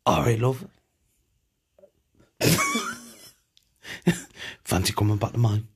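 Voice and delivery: lightly put-on Mackem chat-up voice; a hint of a Mackem flirt; Mackem accent. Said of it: "he's Mackem for the day".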